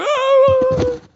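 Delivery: put-on high childlike oh of joy; delighted child cry. Teacher imitates the child's happy cry. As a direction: squeal like a touched little kid. Child reacts to loving words.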